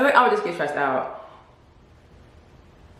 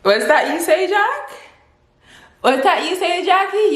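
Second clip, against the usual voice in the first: surprised; 13 semitones higher than usual. Retold or imitated. On the treadmill hearing friend's news, surprised and high. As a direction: feign amazed disbelief. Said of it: "And I was like".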